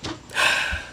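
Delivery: heavy exhale before answering; long breathy sigh, acted; imitated sigh. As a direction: let out a reluctant mock sigh.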